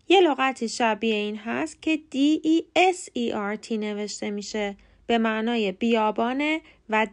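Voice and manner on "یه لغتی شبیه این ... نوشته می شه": ordinary delivery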